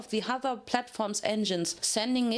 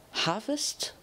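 ordinary delivery, nothing marked

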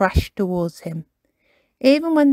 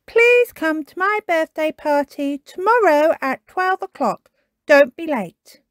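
higher, lightly voiced friend reading; Tiger reading; 9 semitones higher, 4 dB louder than usual. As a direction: read the note aloud as Tiger. Tiger reads the invitation.

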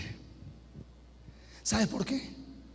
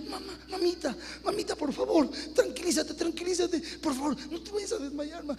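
soothing; 8 semitones higher, 4 dB quieter than usual. Retold or imitated. Son calming his crying mother.

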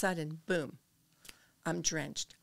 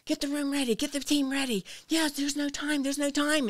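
loud, high imitation of a frantic paramedic; re-enacted emergency panic, raised voice; 9 semitones higher, 8 dB louder than usual. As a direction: shout urgent orders down the phone. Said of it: "he's, like, frantic".

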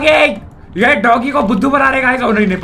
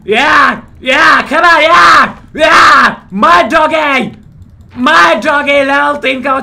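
excited cheering; 5 semitones higher, 6 dB louder, much slower than usual. Real, not acted. Cheering as the dog levels up.

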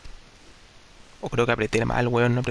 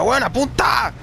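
exasperated; 9 semitones higher, much slower than usual. Real, not acted. Frustrated at bad aim.

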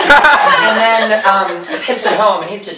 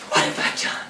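very soft, low, nearly whispered; hushed mock remorse; 7 semitones lower, 12 dB quieter, faster than usual. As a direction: murmur it in acted horror. Miss Havisham's remorse, first take.

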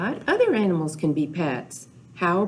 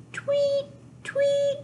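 very high chirpy tweet; bird tweet. Pretend. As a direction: chirp it like a birdie. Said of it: "They say".

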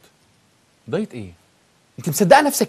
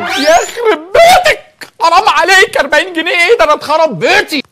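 much higher and louder, laughing in disbelief; shocked outcry; 10 semitones higher, 6 dB louder than usual. Acted. Husband cries out at the forty-pound diet cost, high and laughing. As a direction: cry out at the price.

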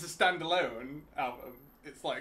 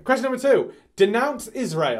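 loud, high shouted question; quiz show host; 7 semitones higher, 12 dB louder than usual. Acted. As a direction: bark the next question out. Plays rapid-fire quiz host.

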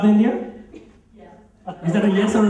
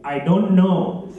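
DON'T KNOW stretched out very slowly; slow repeat; much slower than usual. Acted. Caller repeats for the phone system, slow and deliberate. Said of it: "Please say again".

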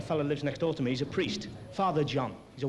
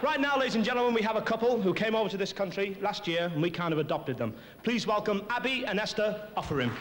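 compere's showbiz introduction, starting high; stage announcer; 3 semitones higher than usual. Acted. Compere welcoming a couple on stage, announcer's delivery. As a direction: announce the next act to the house. Comedian introduces the next act.

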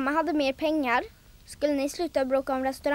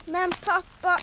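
put-on higher child's plea; pleading protest; 5 semitones higher than usual. Child begging father about violin practice, pleading.

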